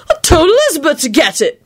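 high-pitched aggravated whine. A narrator's reading. Neville complains about Elizabeth, aggravated. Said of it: "in an aggravated tone".

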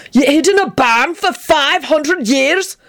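high, slow incredulous question; disbelieving. Acted. Benji questions the barn story, high disbelief. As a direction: stretch it out in sheer disbelief. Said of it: "Benji said disbelievingly".

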